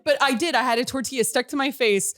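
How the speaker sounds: ordinary talk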